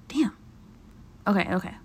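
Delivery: ordinary talk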